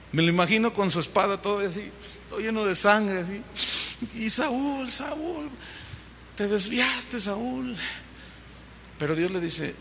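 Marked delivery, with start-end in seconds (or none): 4.3-7.8 soft, mournful calling of the name; mild mournful weeping. Acted. Prophet weeping over the fallen king, soft lament. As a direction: lament him quietly. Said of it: "se va a llorar Samuel".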